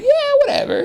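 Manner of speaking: squeaky high laugh through the words. Retold or imitated. Mocking a friend's drunk excuse, laughing high. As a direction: giggle it out, way up high.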